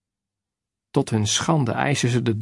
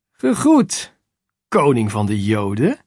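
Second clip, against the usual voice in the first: contemptuous mockery; 3 dB louder than usual. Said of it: "Vol minachting zeggen ze".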